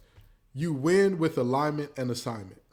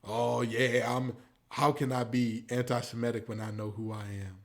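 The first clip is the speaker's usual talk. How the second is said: mocking Kyrie; 5 semitones lower, 5 dB quieter than usual. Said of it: "you standing on business".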